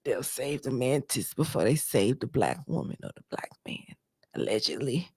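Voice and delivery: soft unvoiced confiding whisper; hushed sarcastic aside. Real, not acted. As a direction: lean in and whisper it. Whispered aside about race.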